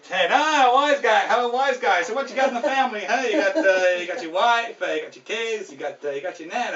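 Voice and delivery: cocky wise guy; Joe Pesci. Acted. Kiosk man quizzing a driver about his family, cocky. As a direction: needle them like a movie mobster. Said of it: "kind of the cocky Joe Pesci guy".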